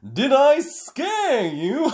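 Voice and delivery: high, loud, slow gleeful question; laughing. A narrator's reading. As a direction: tease them through a laugh. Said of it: "he laughed".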